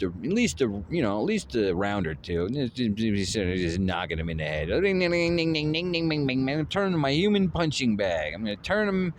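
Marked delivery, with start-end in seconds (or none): 4.7-6.6 high, ringing ding ding like a boxing bell; bell ding sounds. Pretend. Boasting about boxing his rival, he rings bell dings. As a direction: chime it out like a bell in his head. Knocking opponent's head like bell.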